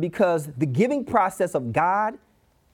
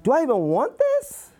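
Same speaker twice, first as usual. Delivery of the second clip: high, incredulous questioning; doubtful grandmother; 7 semitones higher than usual. Acted. Gran receiving a careless gift, high doubtful reaction. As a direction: pitch it up, dubious about the gift. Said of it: "She gonna be like".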